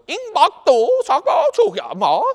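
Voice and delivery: loud, very high mock foreign babble; engineer babbling gibberish. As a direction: shout drawn-out nonsense as if it's another language. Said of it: "the engineer turns over and goes".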